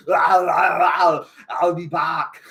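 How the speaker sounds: slow, cried-out Arnold catchphrase; Schwarzenegger on the cross. Retold or imitated. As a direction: wail it from the cross like the Terminator. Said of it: "he's on the cross going".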